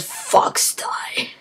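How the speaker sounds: breathless last whisper of the words; mock death-rattle whisper; dying whisper. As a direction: fade out as the virus takes him.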